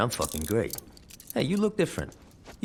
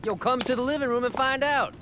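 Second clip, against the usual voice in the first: higher, louder call across the house; 3 dB louder than usual. Acted. Calling to Aisha in the next room, raised voice. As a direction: call her in from another room.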